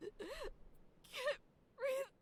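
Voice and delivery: high, crying, breathless plea; panicked crying. Acted. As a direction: sob it out in panic.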